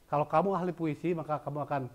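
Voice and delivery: normal talking voice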